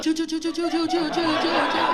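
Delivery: chug it out like a little train; chugging train noise. Retold or imitated. Imitating man thrusting from bottom.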